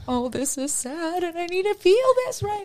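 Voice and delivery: high, put-on wobbly voice; mock sad. Recalling her reaction to her boyfriend, in a high mock-sad voice. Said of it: "I'm just like".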